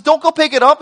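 loud, high, urgent order; emphatic command. Acted. God instructing the harvesters in Scripture, loud emphatic command. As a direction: raise pitch and volume as a firm instruction.

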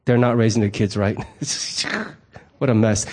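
tut-tut to your spouse behind their back; gossipy disapproval. Retold or imitated. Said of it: "turn around and tell your husband".

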